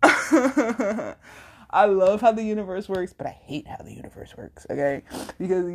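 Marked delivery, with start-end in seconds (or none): 0.0-3.1 laugh, then come in higher and louder; real amusement spilling into the words; amused laughter. Real, not acted. Podcaster laughing at how the universe works, amused. 3.1-4.7 much softer and lower, almost under the breath; low grudging aside. Real, not acted. Podcaster undercutting her love of the universe, grudging aside. Undercuts what she just said.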